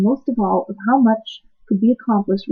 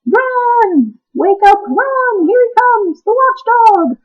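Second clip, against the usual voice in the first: much higher, frantic cries; high, frantic warning shouts; panicked high shouting; 12 semitones higher, 5 dB louder, much slower than usual. Acted. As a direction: yell in panic to wake the others. Lethargarians flee the watchdog.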